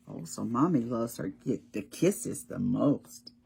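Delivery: usual speaking voice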